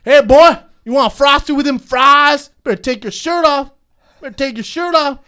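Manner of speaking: high hammed-up Southern creep voice. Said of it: "Be a redneck again".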